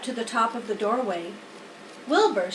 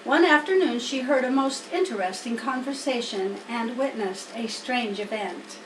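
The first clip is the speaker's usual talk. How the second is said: ordinary delivery, nothing marked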